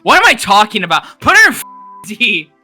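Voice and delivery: loud, high-pitched yell at himself; exasperated outburst. Real, not acted. Co-host correcting his own tier placement, exasperated outburst. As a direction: yell it out, overruling your own words.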